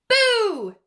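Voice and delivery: loud high boo; shouted boo. Acted. Cub scaring the preening puffins, loud boo. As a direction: pounce with it.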